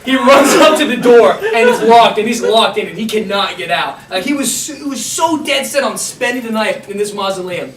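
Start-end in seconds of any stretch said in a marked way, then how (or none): none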